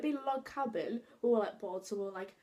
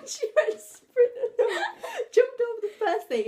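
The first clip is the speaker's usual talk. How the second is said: laughing; 12 semitones higher, 9 dB louder than usual. Real, not acted. Laughing while retelling the jump.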